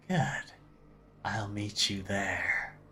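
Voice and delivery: slow, eerie whisper; voice from shadows. Acted. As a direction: whisper it slowly from behind someone. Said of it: "you hear that voice from the shadows".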